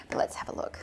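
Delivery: hushed, quick whisper. Real, not acted. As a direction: lean in and whisper. Hushed before cutting bread.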